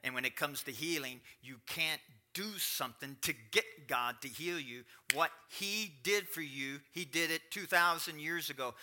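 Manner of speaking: ordinary talk, nothing marked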